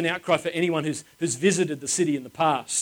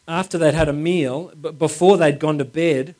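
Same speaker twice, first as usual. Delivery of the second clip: ordinary talk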